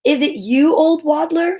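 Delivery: put-on bright-eyed royal girl, surprised; princess voice. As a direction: play the surprised princess calling out. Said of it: "she said".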